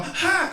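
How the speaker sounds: sharp high yelp of sudden pain. Retold or imitated. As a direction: bark a short high cry of pain. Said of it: "I would yell".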